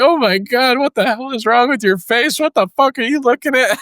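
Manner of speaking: high, giddy, breaking up; laughing apology. Retold or imitated. Sergeant apologizing to the cross-eyed recruit, high laughing voice. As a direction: apologize while cracking up.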